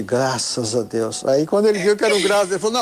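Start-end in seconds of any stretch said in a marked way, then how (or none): 0.0-1.2 low, very slow mock congregation; congregation's response. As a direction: drag it out like a weary crowd at mass. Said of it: "Aí o povo disse".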